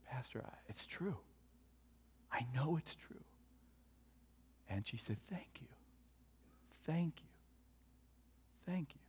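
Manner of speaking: very soft, hushed voice with long pauses; barely voiced last thanks, close to a whisper; dying woman's words. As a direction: softly imitate someone slipping away.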